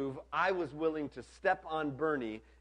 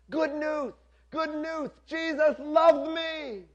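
high, lisped, joyful calling out; Bernie; 12 semitones higher, 7 dB louder, slower than usual. A narrator's reading. Bernie running to another group across camp, calling out excitedly. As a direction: cry it out high, stretching the last words. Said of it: "I can still hear his voice getting fainter".

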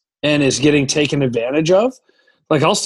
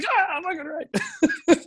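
teammates cheering; 4 dB quieter, slower than usual. Retold or imitated. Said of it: "All the boys like".

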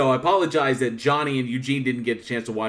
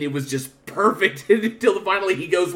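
ordinary talk

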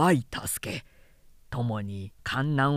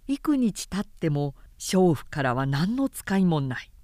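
ordinary delivery

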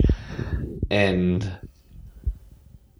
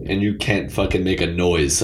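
ordinary talk, nothing marked

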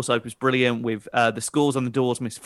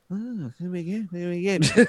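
Bruce impression; 5 semitones higher than usual. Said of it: "And he was like".

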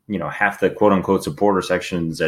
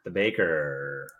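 announcer voice; 3 semitones lower, 8 dB quieter, much slower than usual. Acted. Co-host completes the intro.

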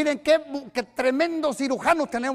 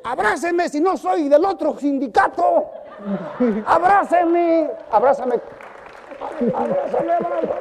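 playful plea; 3 semitones higher than usual. Retold or imitated. Pastor coaxing a stiff man into an embrace, playful. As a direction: tease him into a hug. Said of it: "Le digo".

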